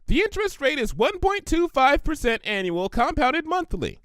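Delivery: high, bubbly pony voice; Pinkie Pie voice. Acted. Pinkie offering Applejack the money, high and eager. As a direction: chirp it out eager and helpful.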